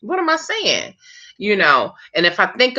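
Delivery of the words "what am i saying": puzzled self-quote. Retold or imitated. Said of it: "I'm like".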